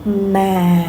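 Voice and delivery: "Ma" sinking slowly, as if tired; falling tone. Shown as a demonstration. Teacher likens it to tired after work, falling tone. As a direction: let "Ma" sag gently downward and linger. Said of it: "like when you are tired after work".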